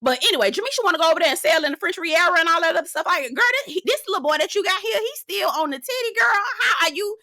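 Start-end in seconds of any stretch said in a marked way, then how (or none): 3.1-7.2 high, laughing shout; laughing disbelief. Real, not acted. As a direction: cackle through the outrage.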